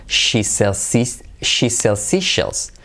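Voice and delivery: "She sells seashells" stretched out very slowly; slow tongue twister. Shown as a demonstration. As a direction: drill each sound slowly and clearly. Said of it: "Repite conmigo".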